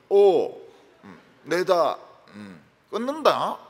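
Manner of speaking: loud, terse Gyeongsang dialect; 무뚝뚝하고 큰 경상도 사투리; blunt Gyeongsang phone talk. Retold or imitated. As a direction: bark it out like a gruff Gyeongsang husband. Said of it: "그 경상도 사람들은".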